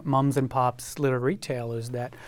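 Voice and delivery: ordinary talk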